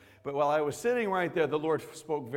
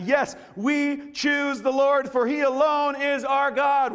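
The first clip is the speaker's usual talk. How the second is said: excited vow; 12 semitones higher, 10 dB louder, slower than usual. Acted. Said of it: "the people replied".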